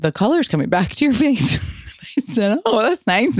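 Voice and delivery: sweet mock voices. Nurse reassures hungover bride, high and sweet. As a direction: play both sides sweetly.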